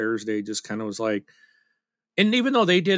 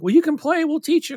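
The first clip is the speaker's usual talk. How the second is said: very high, louder coaxing imitation; eager invitation; 4 dB louder than usual. Players coaxing the shy newcomer, high eager voice. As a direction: pitch it way up, keen to teach. Players urge him to play.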